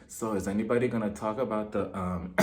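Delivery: low, hushed mock whispering; under her breath. [name] sneaks a word to Melissa, under her breath. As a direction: mumble it secretly to the person beside you. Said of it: "[name] is whispering underneath her breath".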